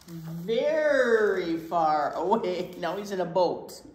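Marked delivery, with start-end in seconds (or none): 0.1-2.7 slow, louder "Very far away"; drawn-out emphasis. Acted. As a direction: stretch it out dramatically. Dramatic emphasis.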